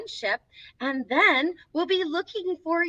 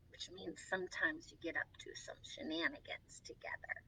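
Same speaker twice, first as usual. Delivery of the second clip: conspiratorial whisper; 8 semitones lower, 21 dB quieter than usual. Acted. Reading to the children, whispering playfully. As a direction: whisper it like a shared secret. Picture book read to children.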